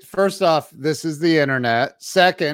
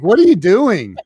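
higher, louder, exasperated parent; exasperated to daughter; 6 semitones higher, 7 dB louder than usual. Retold or imitated. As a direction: call out at the kid, fed up. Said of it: "I'm like".

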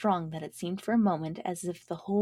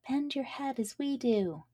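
higher, lilting flower chorus; flowers' voice; 7 semitones higher than usual. Acted. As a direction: sing it up like little flowers. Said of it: "said the flowers".

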